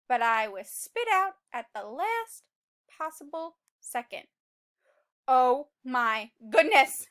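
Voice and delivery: lively, loud read-aloud telling; dramatic story narration. A narrator's reading. Teacher reading the seed's escape to children, dramatic narration. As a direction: tell it like an adventure to kids.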